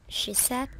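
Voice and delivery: plain reading voice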